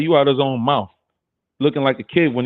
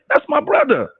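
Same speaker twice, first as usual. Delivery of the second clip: much higher proud thought; inner voice; 10 semitones higher than usual. Retold or imitated. Repeating his thought about [name], high and proud. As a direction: lift it high, like claiming kin. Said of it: "That's what I was thinking".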